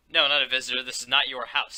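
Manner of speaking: stern. Acted. Mask Girl confronts Poopsie.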